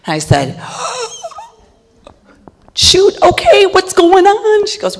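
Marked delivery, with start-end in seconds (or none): 0.7-1.3 sharp high gasp of shock. Retold or imitated. Shocked at failing grade news. 2.7-4.7 alarmed. Retold or imitated. Retold panic at teacher conference.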